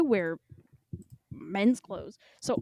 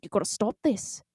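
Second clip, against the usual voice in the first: scolding yourself in fake Irish; clumsy Irish telling-off, rising in pitch; hammed-up Irish self-reproach; bad Irish accent; 4 semitones higher, 3 dB louder than usual. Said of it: "I talked to myself".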